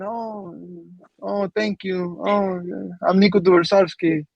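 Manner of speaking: lightly voiced mock-calm young player, drawn out; a hint of a shy, too-calm Nico; calm imitation. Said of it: "Very calm, very calm".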